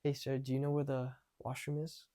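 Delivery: soft, held-back question; timid first attempt. Shown as a demonstration. First nervous practice asking a stranger for the washroom, timid. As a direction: ask softly, barely putting it out. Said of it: "at very first, it would be like this".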